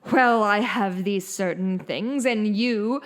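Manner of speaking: a hint of a pompous villain; Dickensian villain voice. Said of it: "talking like they're a Dickensian villain".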